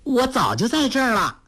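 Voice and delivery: high, lightly voiced young girl; a girl popping out of the flowers, teasing; girl's voice. A narrator's reading. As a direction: play it pert and young. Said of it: "说道".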